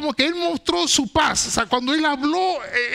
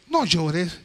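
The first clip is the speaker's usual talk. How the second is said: tender comfort; 8 semitones lower than usual. A narrator's reading. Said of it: "de una manera muy tierna".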